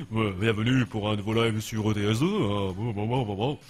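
very deep, low-pitched streamer welcome; Binou impression. Streamer imitating another streamer's live intro, put-on deep voice. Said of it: "Vous prenez Binou, par exemple".

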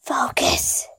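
whispered, no voice at all; whispering. Shown as a demonstration. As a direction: breathe the word in a hush. Repeats word, then whispers it.

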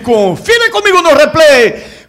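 loud high hype; excited co-commentator. Pretend. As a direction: roar with the goal. Co-commentator hyping the goal.

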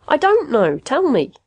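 high, insistent plea; exasperated. A narrator's reading. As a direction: demand an answer, pitched well up. Exasperated patient pleads with machine.

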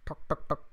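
crisp, voiceless knocking noises; imitated door knock. Storyteller imitating a knock on a neighbour's door. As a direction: mimic three raps on a door. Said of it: "and he knocked".

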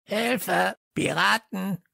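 high-pitched parrot screech. A narrator's reading. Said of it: "kreischte jemand". (kreischender Papagei, hohe Stimme)

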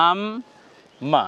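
আম then মা, each said separately with a pause; teacher sounds out the pieces for viewers; split syllable demo. Shown as a demonstration. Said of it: "দেখুন".

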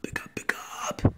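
voiceless urgent whisper; lost voice. Acted. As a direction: whisper it, no voice left. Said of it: "something's wrong with my voice".

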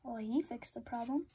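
ordinary delivery

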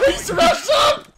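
high, loud excited shout; shrieked astonishment easing into loud delight; gleeful disbelief. Real, not acted. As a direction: yell the discovery, about to crack up. Amazed discovery about teammate.

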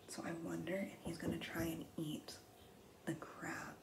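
whispered wondering after a gasp; hushed suspense. Acted. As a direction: drop to an unvoiced, suspenseful whisper. Suspense about shark eating crab.

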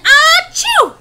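loud, high, shouted "ah-choo"; explosive acted sneeze. Wolf at brick house, explosive acted sneeze. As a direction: let out a huge fake sneeze. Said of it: "and I sneezed once again".

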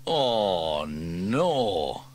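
loud dismayed oh no; mild exclamation. A narrator's reading. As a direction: realize the flaw. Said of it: "exclaimed Sam".

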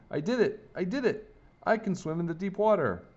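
put-on high-pitched, delighted boy's exclamation; triumphant excitement. A narrator's reading. Boy triumphant after first deep swim.

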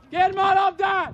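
high, urgent call; urgent shout. Real, not acted. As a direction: yell it across to a player. Coach shouts instruction to player. (sürgető, magas hangú kiáltás)

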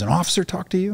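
very high incredulous question; mock freaked-out. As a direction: imitate a superior who can't believe it. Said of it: "He was freaked out".

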